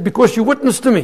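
high, quick, emphatic; eager retold answer. Convert explaining himself to the preacher, eager retold answer.

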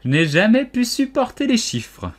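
high, loud outburst; exasperated cry. A narrator's reading. Duchess declaring she hates figures, exasperated cry. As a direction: exclaim with impatient distaste. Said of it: "s'écria la duchesse".

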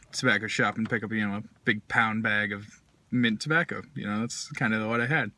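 talking around pipe stem; pipe in mouth. Real, not acted. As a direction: lips clenched on pipe. Seen 1: Pipe stem held in mouth most frames; briefly removed near 7.3s.

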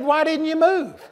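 laughing disbelief. Retold or imitated. Preacher laughing at the crying student. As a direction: ask it almost laughing.

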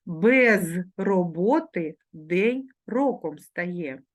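slow, careful proverb read aloud. Shown as a demonstration. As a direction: read it slowly for the class to follow. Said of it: "вимовляючи дзвінки приголосні ... дзвінко".

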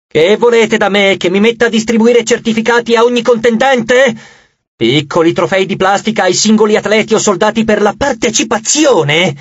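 high, imperious tirade; indignant goddess. Acted. Goddess lecturing Leo on true victory, indignant.

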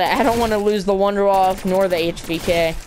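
ordinary talk, nothing marked

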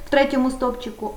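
ordinary delivery

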